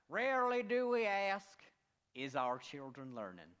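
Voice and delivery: mangle it proudly like Dubya; George W. Bush impression. Pastor's last guess-the-president bit, loud mock Bush. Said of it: "Bush number two".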